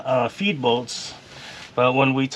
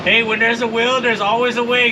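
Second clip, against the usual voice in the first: excited; 10 semitones higher, 5 dB louder than usual. Real, not acted. Proud after tackling the job.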